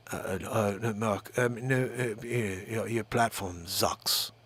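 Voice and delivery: low, soft, halting impression; halting low-voiced tech mogul impression; Elon Musk. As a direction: stammer it low and quietly, full of hesitations. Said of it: "Elon Musk says".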